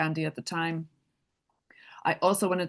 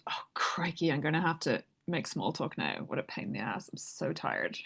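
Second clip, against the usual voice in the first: inner grumble; 3 semitones lower, 7 dB quieter than usual. Retold or imitated. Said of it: "I thought".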